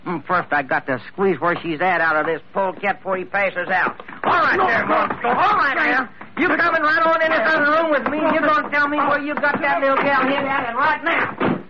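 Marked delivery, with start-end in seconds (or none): 4.2-11.6 raised, higher, forceful command to the captive; rough threat. Acted. Mangan drags captive villain away.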